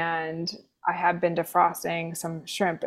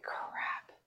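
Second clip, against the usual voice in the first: breathless whispered curse; a hushed dismayed curse; 19 dB quieter than usual. Retold or imitated. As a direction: mutter it under your breath in dread. Realising bad news, whispered.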